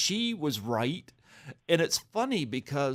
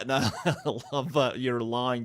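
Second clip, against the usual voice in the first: usual speaking voice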